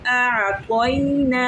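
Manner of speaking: आ तो इना with the आ and ई stretched one alif long; lengthened vowels. Shown as a demonstration. Teacher shows the girls the long vowels in आ तो इना. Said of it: "खींच के पढ़ेंगे".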